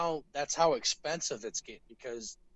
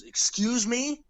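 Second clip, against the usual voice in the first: indignant excuse me; 9 semitones higher, 4 dB louder, slower than usual. Retold or imitated. Dad confronted by maskless man.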